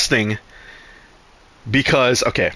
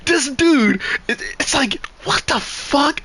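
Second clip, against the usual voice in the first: much higher, stunned disbelief; incredulous outburst; 12 semitones higher, slower than usual. Real, not acted. Telling stream about a gross classmate, shocked high disbelief. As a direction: squeak it out like you can't believe it.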